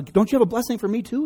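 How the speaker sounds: high, mock-wounded plea; pleading. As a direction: beg for a share, lightly acted. Esau pleads with his father.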